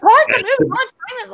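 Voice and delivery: desperate viewer wanting answers; panicked yelling. Retold or imitated. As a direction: yell it at the screen. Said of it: "I'm like".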